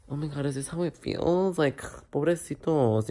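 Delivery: soft, dismayed voice to herself; hushed inner thought. Retold or imitated. Embarrassed student thinking to herself in class, hushed. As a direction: say it under your breath, realizing.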